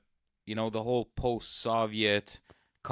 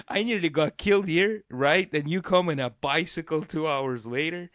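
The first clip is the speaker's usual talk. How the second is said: higher, louder retold protest; indignant disbelief; 5 semitones higher, 3 dB louder than usual. Robbed teenager to late police, indignant disbelief. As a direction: protest it, incredulous and worked up.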